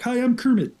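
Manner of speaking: playful frog puppet impression; Kermit the Frog. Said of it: "That's what I sound like".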